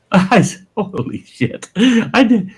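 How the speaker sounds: high, loud, laughing disbelief; laughing at his own stunned reaction; laughing retelling. Real, not acted. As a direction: laugh through the "holy shit" moment.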